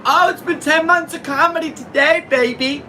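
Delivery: excited cheering. Real, not acted. Comedian celebrating ten months of comedy, excited cheering. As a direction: whoop it up like a victory lap.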